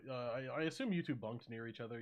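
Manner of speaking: normal talking voice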